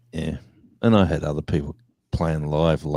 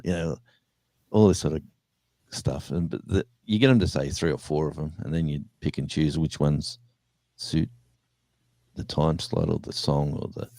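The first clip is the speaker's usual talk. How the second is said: normal talking voice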